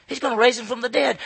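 ordinary talk

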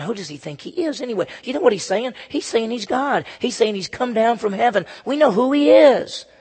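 indignant grumbling. Acted. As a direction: act out a sceptical crowd's complaint. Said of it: "to murmur. It means to talk, and they're going".

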